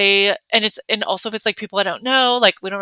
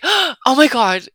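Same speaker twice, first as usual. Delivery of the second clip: excited gasp; 3 semitones higher, 4 dB louder, much slower than usual. Shown as a demonstration. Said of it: "I was like".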